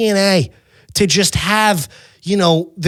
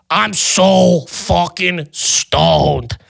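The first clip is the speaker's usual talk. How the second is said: slow, stretched-out mock stoner; stoned drawl; much slower than usual. Stoned tourist confiding in his cousin, slow mock drawl. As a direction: drag each word like a stoner. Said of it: "getting stoned".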